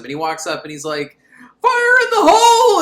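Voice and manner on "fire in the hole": high, loud, bold battle cry; hammed-up action movie yell; action-hero shout. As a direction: yell it like blowing a door. Hero blows the door.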